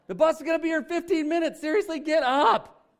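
put-on high, loud, hurried nagging at a sleepy kid; exasperated parent. Parent rousing a kid late for the bus, exasperated and loud. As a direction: yell up the stairs like a fed-up mom.

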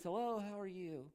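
high, soft, drawn-out phone greeting; fake-sweet, slow hello; sudden sweet switch. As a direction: answer the phone sugary sweet, putting it on. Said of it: "there's a switch somewhere".

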